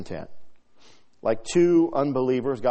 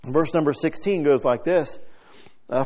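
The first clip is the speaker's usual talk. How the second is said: ordinary talk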